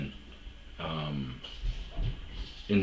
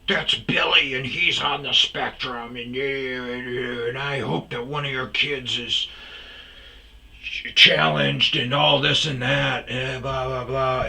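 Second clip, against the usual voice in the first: much higher, louder, slow sanctimonious voice; mock preachy commenter; 7 semitones higher, 4 dB louder than usual. Mocking a Facebook commenter scolding her, in a much higher, sanctimonious voice. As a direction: lecture it like a self-righteous commenter. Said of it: "he was like".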